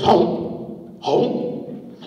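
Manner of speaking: breathy voiceless gulp sound; hollow, whispered chomps; snake gulping. Acted. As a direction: imitate a snake gulping food. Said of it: "And the snake goes".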